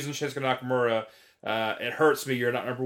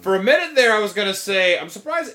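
excited; 6 semitones higher, 6 dB louder than usual. Real, not acted. Reacting to the pick.

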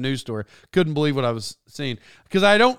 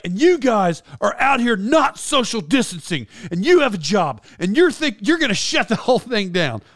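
raised-pitch mock scolding; imitated yelling; 5 semitones higher than usual. Star scolding crew for not social distancing, mock yelling. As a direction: act out a star berating the crew. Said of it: "he's yelling at 'em".

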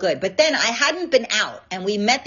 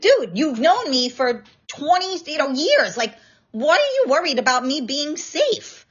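exasperated retort; 5 semitones higher than usual. Retold or imitated. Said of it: "I'm like".

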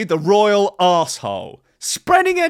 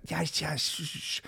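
much softer shushing; hushing; 5 semitones lower, 15 dB quieter than usual. Pretend. Husband shushing his suspicious wife, very softly. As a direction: hush her down to nothing.

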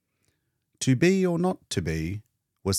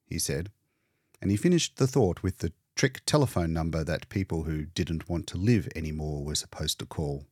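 plain reading voice

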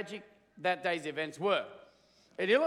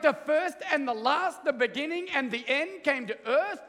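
higher, eager disbelief; pumped alien; 6 semitones higher than usual. Retold or imitated. Alien questions Michael, amazed and eager. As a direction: press him for the truth, amazed. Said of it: "the alien is just pumped".

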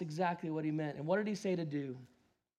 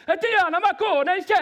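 put-on shouted Italian accent, much higher; 12 semitones higher, 12 dB louder than usual. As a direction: yell it like an angry Italian local. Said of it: "That's my Italian accent for you".

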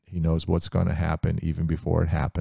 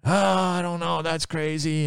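high, scoffing dismissal; 11 semitones higher than usual. Acted. Naaman waving off his servant's plan: high scoffing. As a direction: brush it off with disbelief.

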